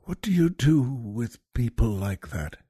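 hushed whisper, dropped low; quiet whisper. Retold or imitated. Mrs. Miniver whispering to the soldiers, stunned and hushed. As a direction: breathe the question out under your breath. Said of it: "says something quietly to them".

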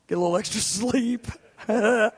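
breaking into laughter mid-sentence; laughing. Real, not acted. Father of three joking about rest with a new baby, laughing. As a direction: laugh through the wishful thought.

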